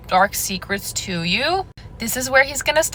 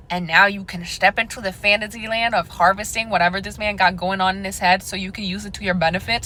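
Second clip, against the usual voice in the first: normal talking voice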